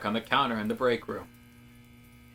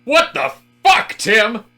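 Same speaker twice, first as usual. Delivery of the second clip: far higher and louder, a scream of disbelief; outraged shout; 10 semitones higher, 10 dB louder than usual. Acted. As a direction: yell at Tim for killing the fire. Fire extinguished, outraged at Tim.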